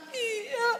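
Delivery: high, tearful little yes; a sobbing, high-pitched yes; crying yes. Retold or imitated. Woman crying agrees. (iya sambil menangis)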